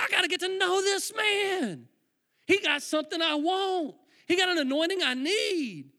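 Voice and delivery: loud, high, drawn-out longing; hammed-up, impassioned resolve; fervent awe. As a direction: declare it with slow, overwhelmed fervor. Acts out his awe, retold.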